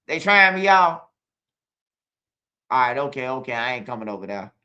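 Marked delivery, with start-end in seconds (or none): 0.1-1.1 indignant. Real, not acted. Indignant to viewers.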